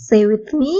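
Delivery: ordinary delivery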